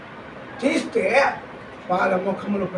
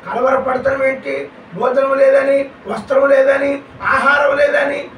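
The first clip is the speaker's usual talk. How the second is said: loud, higher, building exhortation of a divine voice; God encouraging Elijah; 4 semitones higher, 3 dB louder than usual. Acted. God urging tired Elijah onward, loud booming encouragement. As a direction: lift the weary prophet with a booming call.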